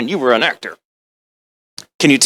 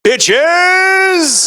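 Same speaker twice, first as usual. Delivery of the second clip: high, loud, drawn-out holler; shouted punchline; 6 dB louder than usual. Acted. Actor wrecks his Hamlet soliloquy, hollered. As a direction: explode crassly out of solemn verse.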